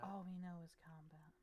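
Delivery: a soft whispered repeat; whispered echo. Pretend. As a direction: breathe the words like a secret. Echoing friend's line in whisper.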